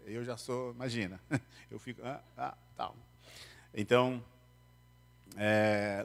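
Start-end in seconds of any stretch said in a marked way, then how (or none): none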